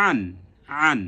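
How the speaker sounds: আন voiced from the middle of the throat; throat letter demo. Shown as a demonstration. Teacher shows students the throat sound, আন from mid-throat. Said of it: "মাঝখান থেকে উচ্চারণ হবে".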